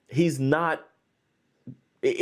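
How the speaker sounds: normal talking voice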